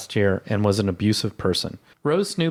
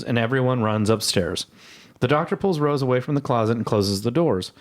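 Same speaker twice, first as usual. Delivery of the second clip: usual speaking voice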